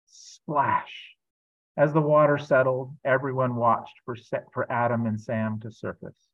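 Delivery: lively storybook reading voice; reading to children. A narrator's reading. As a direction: read it like a picture book aloud. Pastor reads a children's book.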